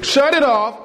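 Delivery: ordinary talk, nothing marked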